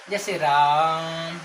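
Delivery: राम held out slowly like a call; prolonged calling. Shown as a demonstration. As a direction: stretch राम as if calling someone. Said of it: "सिर्फ पुकारने के लिए".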